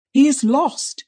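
high, distressed faint voice; faint, catch in breath. A narrator's reading. Niece confessing the boy is lost, faint and catching. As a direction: break the bad news with a catch in the breath. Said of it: "in a faint voice and with a catch".

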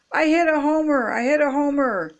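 high, crowing storybook holler; excited holler. A narrator's reading. Froggy bursting in home with his news, hollering. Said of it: "he hollered".

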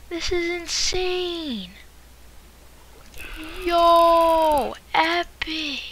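high, loud thrilled shouts. Real, not acted. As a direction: gasp and cheer at the loot. Thrilled by rare loot.

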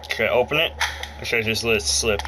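usual speaking voice

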